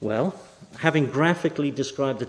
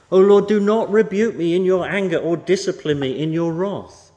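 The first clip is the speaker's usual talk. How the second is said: raised, pleading cry to God, a touch louder; complaining prayer; 4 semitones higher, 7 dB louder than usual. Acted. David complaining to God in raised, pleading prayer. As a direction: plead loudly as if wounded by God. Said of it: "he complains to God".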